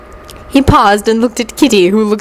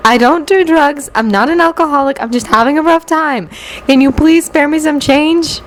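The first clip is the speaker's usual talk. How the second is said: higher-pitched panhandler's spiel; recited begging speech in a raised pitch; pleading beggar; 6 semitones higher than usual. A narrator's reading. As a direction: plead your case, high and earnest.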